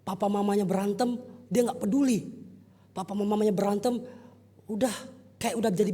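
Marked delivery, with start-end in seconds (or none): none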